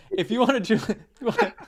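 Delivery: words broken by laughter; laughing while talking. Real, not acted.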